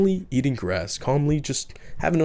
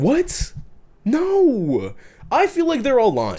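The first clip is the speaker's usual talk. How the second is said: outraged disbelief; 10 semitones higher, slower than usual. Real, not acted. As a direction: balk at the claim in shock. Outraged disbelief at seven feet.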